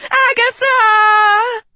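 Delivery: high, slow, loud shriek. A narrator's reading. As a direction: shriek the name in terror. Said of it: "she shrieked".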